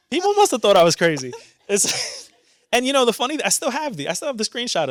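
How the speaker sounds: laughing. Real, not acted. Laughing recalls crying in movie.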